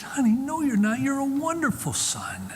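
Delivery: high, tender imitated mother; reassuring mother. Mother reassuring her son after he confesses, tenderly.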